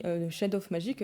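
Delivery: ordinary talk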